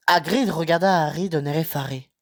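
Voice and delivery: usual speaking voice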